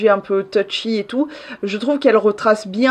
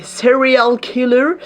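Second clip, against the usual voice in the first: slow, drawn-out American English accent; 2 semitones higher, slower than usual. Shown as a demonstration. Vlogger mocks her own American accent on "serial killers". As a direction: say it like an American, laughing at yourself. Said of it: "avec mon accent anglais, ... américain même".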